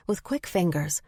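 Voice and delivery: ordinary delivery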